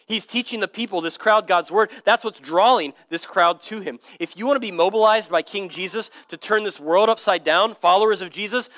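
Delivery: usual speaking voice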